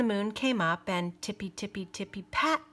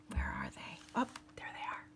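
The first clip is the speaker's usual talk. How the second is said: whisper; 15 dB quieter, faster than usual. Real, not acted. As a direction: whisper as if searching. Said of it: "aside to listeners".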